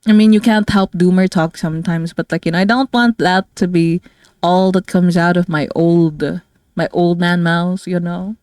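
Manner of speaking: ordinary delivery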